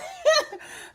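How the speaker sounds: high squealing laugh; burst of laughter. Real, not acted. Host laughing at viewers' comments about choking, high burst of laughter.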